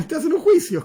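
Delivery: amused chuckle while telling it; real laugh breaking in. Real, not acted. Laughing at the Brazil reaction.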